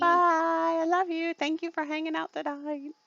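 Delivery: high sing-song goodbye; cutesy farewell. Real, not acted. Host thanking a friend for hanging out, cutesy high voice. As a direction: coo it sweetly up high.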